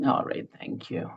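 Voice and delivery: weak, breathy whisper; barely voiced thanks. Real, not acted. Unwell speaker answers host softly.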